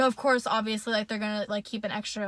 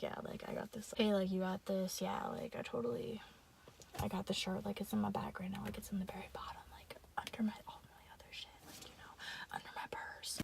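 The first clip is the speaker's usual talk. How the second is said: hushed unvoiced whisper, much softer; secret whisper; 13 dB quieter than usual. To her friend in the store, whispering. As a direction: whisper so the watching clerk can't hear. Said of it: "pretending, like".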